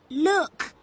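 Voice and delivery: high, loud warning shout; boy shouting at the burning house. A narrator's reading. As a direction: shout to point it out. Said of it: "Toby shouted".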